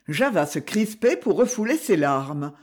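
plain reading voice

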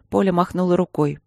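usual speaking voice

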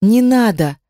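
ordinary delivery

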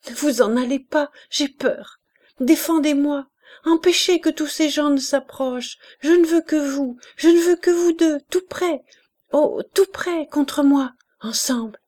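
put-on high, loud frightened child pleading; cling to them in feverish terror; peur délirante. A narrator's reading. Enfant délirante supplie, effrayée. (supplication apeurée d'enfant fiévreuse)

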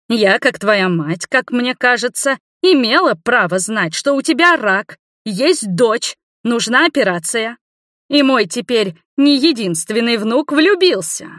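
high, indignant complaint; loud, stern grievance. A narrator's reading. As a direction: declare your grievance menacingly, stretching key phrases. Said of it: "громко и грозно с претензией".

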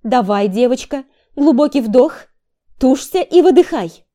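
high, loud push coaching; coaching call. Acted. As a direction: cheer her through the push.

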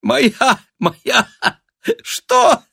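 high squeaks between bursts of laughing; breathless, ecstatic cackling speech; delighted helpless laughter. A narrator's reading. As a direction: struggle to get each word out through glee. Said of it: "В восторге сумела выговорить".

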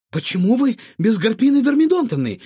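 high, loud baffled question; astonished. A narrator's reading. Boy notices someone missing amid the fight, astonished. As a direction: ask in sharp amazement. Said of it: "изумился Егор".

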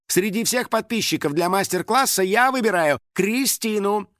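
high, showy announcement; announcing. A narrator's reading. Star naming contest winner to fans, announcing. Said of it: "крикнула Лиза".

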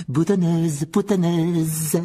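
loud, high, drawn-out jeer; Peeves shouting taunts. A narrator's reading. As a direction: yell it after her, stretching the vowels. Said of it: "en criant". (cri moqueur, aigu et traînant)

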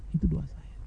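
breathy whisper, barely voiced. Real, not acted. To the congregation after his prayer, a soft whisper. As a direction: let the words fall to a whisper.

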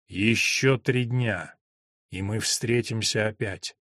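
slow, quiet voice from the grave; a faint hollow ghost speaking; ghostly voice. Acted. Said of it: "слабый, глухой, замогильный голос". (замогильный голос призрака)

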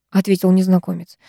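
plain reading voice

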